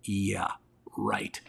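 low, soft deadpan disbelief; dry eye-roll in the voice; sarcastic wife. Retold or imitated. Wife doubting his good-looks story, low deadpan sarcasm. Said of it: "And Holly's like".

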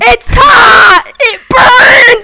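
high, loud cries of burning pain; pained shouting. Acted. Player crying out at the DM after the soup burns, high pained yelling. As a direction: shout it out as if scalded. Burned by thrown hot soup.

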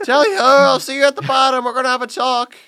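high, loud hammed-up call; mock jolly holler. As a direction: holler it like a merry send-off. Mock shout down the stairs.

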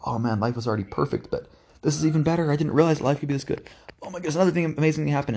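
mock overjoyed gush at good news; giddy awe. Imitating overjoyed inner voice.

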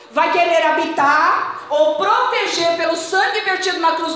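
loud fervent preaching; full-force sermon. Acted. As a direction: hammer the point home from the pulpit.

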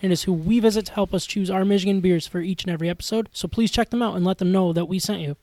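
quiet, even sponsor read. Acted. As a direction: read the ad like a script. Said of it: "It probably wouldn't even sound as computerized as I do".

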